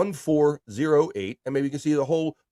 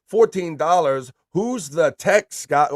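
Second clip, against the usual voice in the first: loud, high, slow reading; mock hype read; 5 semitones higher, 6 dB louder, slower than usual. Host reading a tip joke aloud, loud and high. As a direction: read it out big with a laugh.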